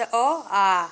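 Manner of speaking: आ held long and slow after a short अ; drawn-out vowel demo. Shown as a demonstration. Teacher showing pupils the long आ, slowly. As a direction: stretch the आ out, keep अ short. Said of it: "आ में ज़्यादा समय लग रहा है ना".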